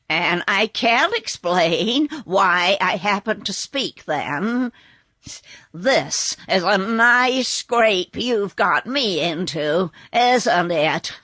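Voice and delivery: put-on slow, grumbling old horse; a put-out talking horse. Horse character voice.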